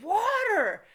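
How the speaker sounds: one high, loud screech; imitated screaming. Boss screaming at worker over running tap, high mock shriek. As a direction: mimic a boss shrieking a single word.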